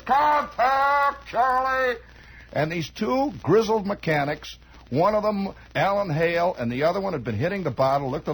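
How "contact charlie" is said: hollered command. Retold or imitated. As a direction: bark it out like a barnstormer. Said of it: "this guy hollers".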